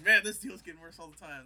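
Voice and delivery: a loud exasperated gripe; loud complaint. Retold or imitated. Lando griping at Vader's new terms, loud and exasperated. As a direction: complain loudly at the worsening deal. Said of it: "And he's like".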